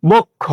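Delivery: বক্ষ as bok-kho, broken at the doubled consonant; o-ending pronunciation. Shown as a demonstration. Teacher answers what it became, o-ending pronunciation. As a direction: say বক্ষ with a clear final o. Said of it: "তাহলে এটা উচ্চারণে ও হয়ে যাবে".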